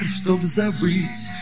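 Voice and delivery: normal talking voice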